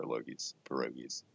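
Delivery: soft and low, teasing. Acted. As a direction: murmur the nickname playfully. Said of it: "I got one".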